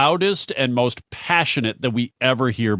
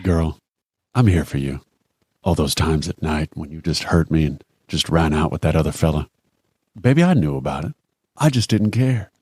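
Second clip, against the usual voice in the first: much lower spoken-word croon; deep bass spoken-word ballad part; 9 semitones lower than usual. Pretend. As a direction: drop deep like a bass singer. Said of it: "You could say this better than me with your low bass voice".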